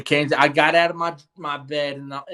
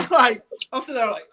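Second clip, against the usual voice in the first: laughing; 9 semitones higher than usual. Real, not acted. Laughing at the memory.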